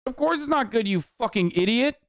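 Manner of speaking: ordinary talk, nothing marked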